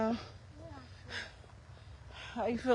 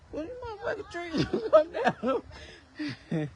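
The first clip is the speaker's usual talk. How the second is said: higher, playful cussing with a laugh breaking in; mock scolding trees; 6 semitones higher than usual. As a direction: tell off the trees like they started it. Cursing trees, then laughing.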